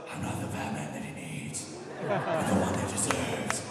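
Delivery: low, slow, raspy Batman growl. Retold or imitated. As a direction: growl it like a gravel-throated Batman. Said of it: "that's the voice".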